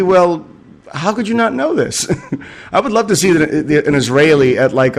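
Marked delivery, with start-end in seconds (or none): 0.0-2.1 pitched much higher in mock disbelief; a hammed-up how-could-you-not-know; mock incredulous Israel. Israel mocking its allies' naivety, higher mock disbelief. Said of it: "Israel is basically".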